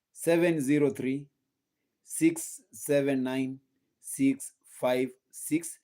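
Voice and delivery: normal talking voice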